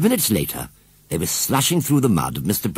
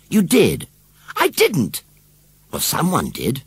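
put-on children bickering; 9 semitones higher, 4 dB louder, slower than usual. Children quarrel in story.

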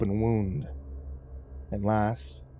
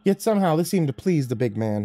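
usual speaking voice

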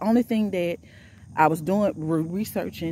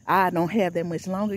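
ordinary talk, nothing marked

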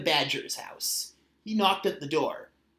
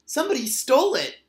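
high, loud and quick cry; little Otter yells it out in alarm; shouted; 8 semitones higher, 5 dB louder, faster than usual. A narrator's reading. Little otter shouting that his pine cone is stolen. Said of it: "he shouted".

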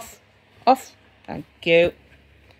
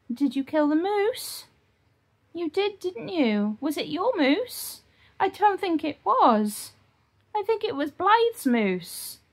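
high, slow mock scolding of the dog; mock telling-off; 7 semitones higher, 4 dB quieter, slower than usual. Real, not acted. Owner teasing her dog about the ripped toy, slow and high mock scolding. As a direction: tease the dog like a fake stern parent.

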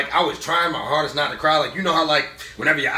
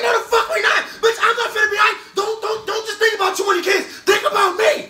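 indignant protest; 4 dB louder than usual. Retold or imitated. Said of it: "I was like".

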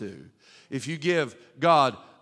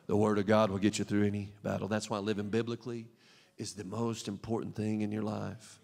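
low, hushed pulpit appeal; solemn preaching; 6 semitones lower, 9 dB quieter than usual. Real, not acted. Preacher urging church to trust scripture in battles, hushed preaching. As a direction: bring it right down, soft and earnest.

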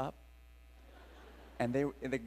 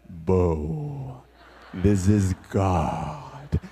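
much lower, slow, solemn divine voice; booming God voice; 10 semitones lower, 7 dB louder, much slower than usual. Pretend. Preacher mocking how God did not speak to him, booming and slow. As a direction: intone it like a movie voice of God.

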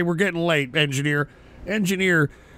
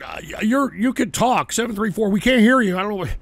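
ordinary delivery

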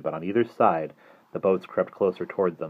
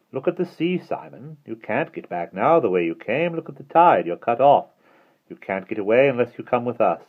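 higher, swooping light voice; taunting villain; 5 semitones higher, 4 dB louder than usual. A narrator's reading. Withers warns the children they are trapped, taunting. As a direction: press them with mocking warnings.

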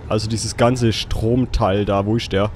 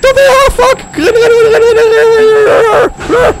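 high, loud panicked screams; terrified screaming; 9 dB louder, slower than usual. Real, not acted. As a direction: scream while running for your life. Jump scare, fleeing monster.

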